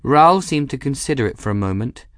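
ordinary delivery, nothing marked

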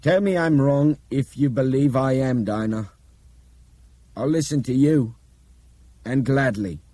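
loud, high, insistent appeal; forceful and urgent; urgent challenge. A narrator's reading. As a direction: press her earnestly for an answer. Nick urgently challenges Dinah after his tirade.

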